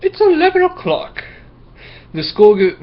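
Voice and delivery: put-on a shrill-pitched schoolteacher calling out a late boy; stern Miss Block. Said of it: "said Miss Block".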